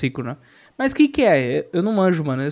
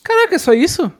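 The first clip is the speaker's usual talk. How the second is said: surprised; 12 semitones higher, 5 dB louder than usual. Real, not acted. Amazed reaction to simple explanation.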